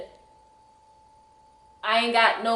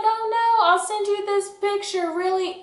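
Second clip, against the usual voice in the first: fake reassurance; 11 semitones higher than usual.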